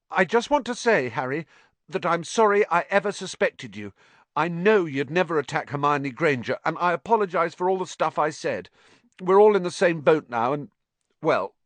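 high, earnest formal apology. A narrator's reading. Ernie formally apologizing to Harry, high and earnest. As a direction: apologize stiffly and properly. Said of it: "said very formally".

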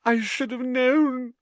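high, slow, tearful wail; tragic. A narrator's reading. Lavender grieving for her rabbit, tragic. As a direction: lament it with high drama. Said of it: "said Lavender tragically".

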